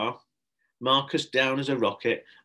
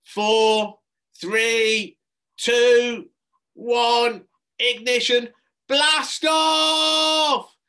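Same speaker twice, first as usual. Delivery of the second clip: high, loud building yell to blast off; rising loud shout; excited yell; 13 semitones higher, 9 dB louder, much slower than usual. A narrator's reading. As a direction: burst in yelling the launch. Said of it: "yelled a woman".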